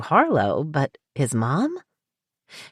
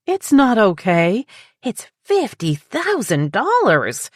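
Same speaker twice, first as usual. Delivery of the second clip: indignant protest; 7 semitones higher, 3 dB louder, slower than usual. A narrator's reading. Judy objects to the deposit.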